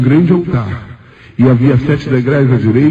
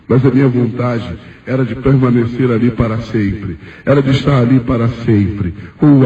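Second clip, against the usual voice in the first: ordinary talk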